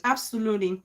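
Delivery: normal talking voice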